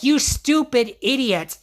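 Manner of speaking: high, drawn-out mock fury; angry outburst. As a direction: lash out as the betrayed friend, stretching the insult. Retelling an angry falling-out between friends.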